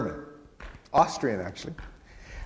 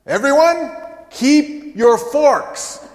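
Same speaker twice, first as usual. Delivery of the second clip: high, loud, slow proclamation; announcing to everyone; 13 semitones higher, 10 dB louder, much slower than usual. Retold or imitated. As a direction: call it out across the potluck crowd. Pastor's potluck announcement, voiced by Hilda.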